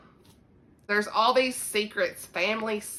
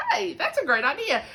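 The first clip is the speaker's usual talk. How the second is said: excited reply; 9 semitones higher than usual. Retold or imitated. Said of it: "And I was like".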